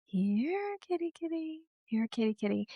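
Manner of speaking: high, soft, coaxing cat call. Acted. Owner coaxing an aloof cat, cat-calling voice. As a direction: lure a cat over in a small high voice. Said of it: "So you change your voice".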